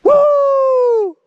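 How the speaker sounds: loud, high whoop. Real, not acted. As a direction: shout it out across the canyon. Shouting into the canyon.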